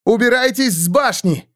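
high, loud urgent shout. A narrator's reading. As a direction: yell a warning. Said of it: "крикнула Кэт".

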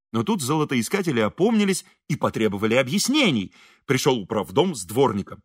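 usual speaking voice

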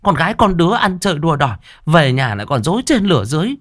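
high, shrill-pitched scolding shrew; scolding stepmother. Acted. Stepmother scolds the girl in a high furious voice. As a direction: berate her, stammering with rage. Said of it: "Quát mắng chưa đủ".

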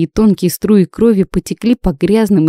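plain reading voice

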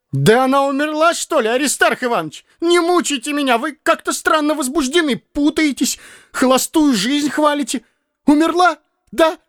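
begging to be told the truth; a frightened clerk pressing the old man; anxious young man. A narrator's reading.

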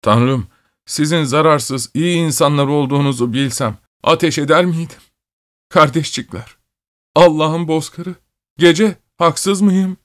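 weeping, plaintive cry at a high pitch; trembling, fearful wail. A narrator's reading. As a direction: wail timidly, pitch rising toward the end. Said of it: "ürkek bir sesle uluyordu".

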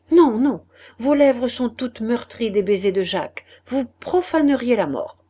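loud, high and outraged; a loud, scandalised no; fierce refusal. A narrator's reading. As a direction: push her away with the words. Claude refuses Laurence's kiss.

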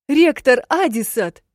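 high, loud, slow begging; pleading cry. A narrator's reading. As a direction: beg for help at full voice. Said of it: "взмолилась я". (отчаянная мольба)

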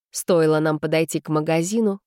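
usual speaking voice

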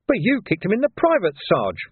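high-pitched junior protest; protesting young constable. A narrator's reading. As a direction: object to the sergeant in a high voice. Young constable protests to his sergeant.

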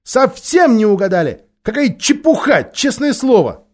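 put-on high, loud girl's shout. A narrator's reading. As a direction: yell it in protest. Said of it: "Полли крикнула".

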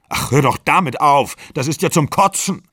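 acted quarrel, sick of hearing it; exasperated retort. A narrator's reading. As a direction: cut in with acted disgust.

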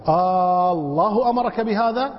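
long held آه, then بهذا lifting in a question; interrogative long alef. Shown as a demonstration. Teacher quotes a verse as example of questioning, alef prolonged. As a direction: stretch آه before الله and rise at the end. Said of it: "استفسار".

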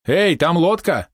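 high, drawn-out lookout's call; shouted warning. A narrator's reading. Helmsman spots a boat ahead, shouting. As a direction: call out slowly at a high pitch. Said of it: "крикнул кормчий".

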